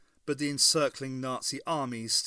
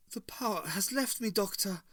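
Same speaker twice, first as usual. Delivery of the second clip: whispered; 8 semitones higher than usual. A narrator's reading. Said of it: "whispered Hitler".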